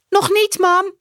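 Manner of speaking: hoog en luid, een kind dat roept; roepend meisjesstemmetje. A narrator's reading. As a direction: roep het als een klein meisje naar de gang. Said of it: "roept Saar". (hoog kinderstemmetje dat naar mama roept)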